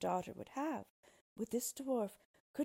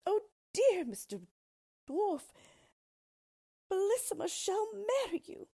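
much higher, much louder desperate crying out; loud terrified cry; 14 semitones higher, 8 dB louder than usual. Acted. Terrified queen begging the dwarf, desperate loud cry. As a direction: scream it out in panic. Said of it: "cried out as loud as she could".

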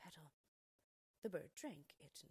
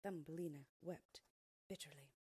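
ordinary delivery

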